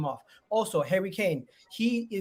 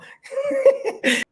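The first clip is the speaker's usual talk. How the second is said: loud gloating laugh; 9 dB louder than usual. Real, not acted. Laughs after teasing.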